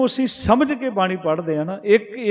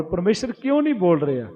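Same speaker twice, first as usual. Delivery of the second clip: ordinary delivery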